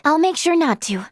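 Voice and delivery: ordinary talk, nothing marked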